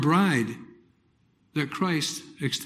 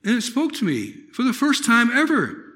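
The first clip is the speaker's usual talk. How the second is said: ordinary delivery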